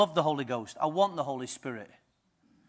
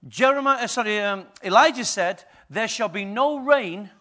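building, louder and higher to a ringing climax; preaching at full force; 4 semitones higher, 6 dB louder than usual. Acted. Preacher rallying the congregation as rainmakers, full force. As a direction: preach it, rising to a triumphant shout.

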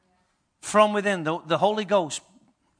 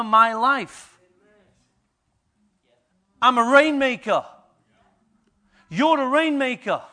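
building, louder and higher to a ringing climax; preaching at full force; 4 semitones higher, 6 dB louder than usual. Acted. Preacher rallying the congregation as rainmakers, full force. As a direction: preach it, rising to a triumphant shout.

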